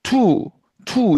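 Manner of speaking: 투 said as one Korean syllable, vowel fused to the consonant; Korean-style tu. Shown as a demonstration. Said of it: "이렇게 발음하는 거 아닙니까".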